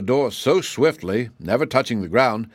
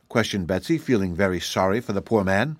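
plain reading voice

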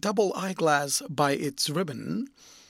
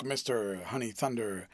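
plain reading voice

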